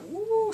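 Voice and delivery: very high "woo"; impressed "woo". Real, not acted. Viewer reacting to the ultimatum, high whooped woo.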